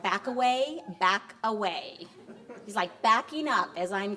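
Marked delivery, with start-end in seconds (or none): none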